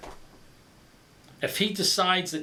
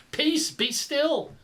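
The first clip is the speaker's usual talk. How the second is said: high, slow, powerful command; calming command; 10 semitones higher, much slower than usual. Pretend. Jesus rebuking the raging sea, slow command. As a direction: still the sea with one word. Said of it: "Whatever he said".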